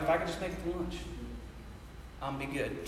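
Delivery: low, quiet muttered wish to himself; hushed low office daydream mutter. Retold or imitated. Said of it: "you're like".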